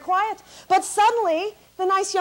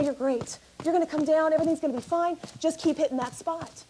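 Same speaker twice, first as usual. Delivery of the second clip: softer, calm soothing; soothing coaching; 5 dB quieter than usual. Retold or imitated. Comic coaching a panicking seatmate, softer and soothing. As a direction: ease her down gently.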